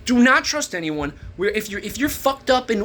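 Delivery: ordinary talk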